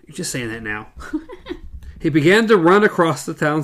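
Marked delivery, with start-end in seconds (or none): 1.0-1.9 high airless giggle; breathy giggle. Real, not acted. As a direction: laugh under the breath at the joke. Laughing at own joke.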